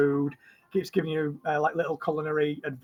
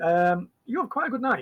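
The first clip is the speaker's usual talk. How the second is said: usual speaking voice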